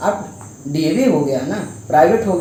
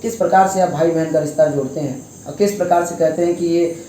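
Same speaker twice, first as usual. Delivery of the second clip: ordinary delivery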